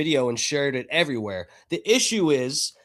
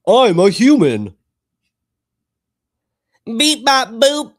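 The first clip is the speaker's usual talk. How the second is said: high, loud mock robot; beep-boop android voice; 7 semitones higher, 9 dB louder, slower than usual. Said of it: "sounds like a fucking robot".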